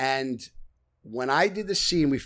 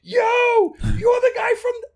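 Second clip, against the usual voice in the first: much higher, louder, slow drawn-out yell; excited shout; 7 dB louder, slower than usual. Retold or imitated. Crew calling out to the actor, excited shout. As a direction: call out like a fan spotting him. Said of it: "they were like".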